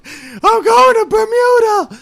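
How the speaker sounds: very high, feminine shriek; high-pitched Night King. Pretend. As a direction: squeal it like a girly villain. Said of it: "super high-pitched and very feminine".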